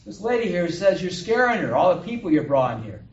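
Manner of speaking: loud acted holler, starting high; hollered storytelling dialogue; loud complaint. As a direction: shout the accusation across the room. Customer shouts back the complaint.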